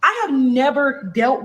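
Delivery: high, slow, exasperated; drawn out in shock; stunned disbelief. Real, not acted. Asking viewers about the abuse, high and stunned. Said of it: "disbelief at the messages".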